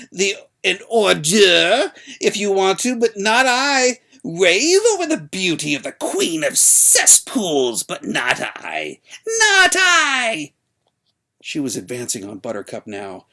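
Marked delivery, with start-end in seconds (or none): 0.1-10.5 high, loud, slow hag's defiance; crone yelling louder. A narrator's reading. Old woman advancing on Buttercup, yelling high and loud. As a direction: hurl the final refusal at full volume. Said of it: "her voice getting louder and louder".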